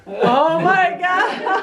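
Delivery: high, stretched-out disbelief with laughter; amazed exclamation. Real, not acted. Listener hearing of ghostly music, amazed and laughing. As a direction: gasp it slowly, high, half laughing.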